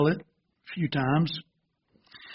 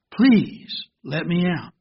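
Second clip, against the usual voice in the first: usual speaking voice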